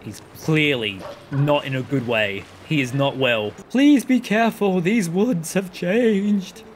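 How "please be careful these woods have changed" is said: slow, higher, grave spirit voice; solemn spirit. Acted. Gamer voicing the light spirit warning the hero, solemn. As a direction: intone it like an ancient being.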